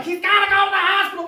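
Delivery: fast, high, loud alarm; panicked mother. Acted. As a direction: cry out urgently like an alarmed mother.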